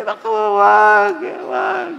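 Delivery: loud, high, slowed cry of no; preacher protesting in mock horror; mock alarm. Real, not acted. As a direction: wave it off in comic alarm.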